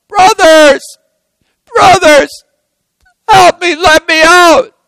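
desperate, very high-pitched loud yell; crying out. Acted. Joseph calling to his brothers from the pit, crying out desperately. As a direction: call up for help from a pit. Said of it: "he cries out".

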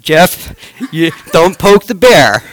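laughing through the words; loud, laughing warning. Real, not acted. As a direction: laugh it out as a joke. Joking about his brother.

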